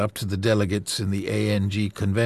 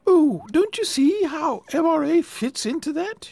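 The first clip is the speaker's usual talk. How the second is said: beaming. Acted. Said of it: "she beamed".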